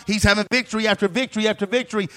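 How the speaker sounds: ordinary talk, nothing marked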